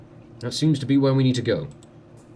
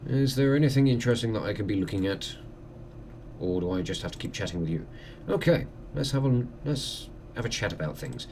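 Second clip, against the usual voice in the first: ordinary delivery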